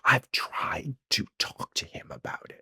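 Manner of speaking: slow, hushed confiding whisper; exasperated whisper. Real, not acted. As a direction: whisper it slowly, as a weary confession. Confiding exasperation about his friend.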